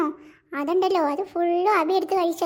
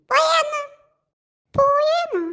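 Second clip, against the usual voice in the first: indignant retort; 6 semitones higher, slower than usual. Acted. Sisters indignantly confronting boy.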